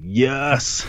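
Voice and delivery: triumphant yes. Retold or imitated. Triumph after getting the note.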